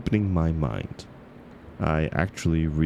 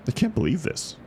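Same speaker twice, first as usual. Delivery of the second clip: disbelief; 11 semitones higher than usual. Acted. Reader realizing the book isn't romance, stunned disbelief. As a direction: pitch jumps high in sudden disbelief.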